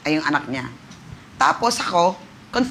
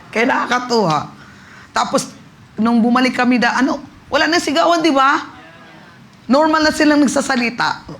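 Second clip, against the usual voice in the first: high, loud, thrilled retelling; excited testimony; 7 semitones higher, 5 dB louder than usual. Real, not acted. Pastor recounting the healing to church, thrilled and loud. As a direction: let the joy burst out.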